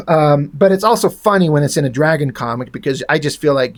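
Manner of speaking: usual speaking voice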